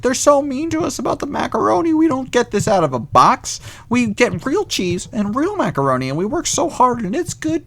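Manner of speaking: high, fast complaining lunch lady voice, hammed up; mock cafeteria worker. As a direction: whine like an offended cafeteria cook defending her macaroni. Cafeteria workers quoted in retelling.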